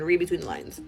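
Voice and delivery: ordinary talk, nothing marked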